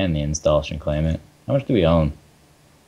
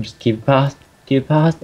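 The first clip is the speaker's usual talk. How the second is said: mock pleading; 7 semitones higher than usual. Jokingly pleading with stream.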